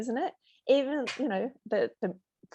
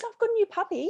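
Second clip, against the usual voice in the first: much higher, louder, bubbly puppy news; excited mentee; 12 semitones higher, 5 dB louder, faster than usual. Retold or imitated. Mentee telling her coach about her puppy, excited. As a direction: gush about the new puppy.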